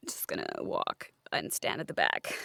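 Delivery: whispered retreat to the back. Acted. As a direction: slip away in a whisper, unvoiced.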